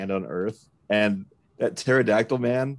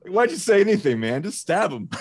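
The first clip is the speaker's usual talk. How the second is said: exasperated; 10 semitones higher, 5 dB louder than usual. Retold or imitated. Mock exasperated yell at Leonardo.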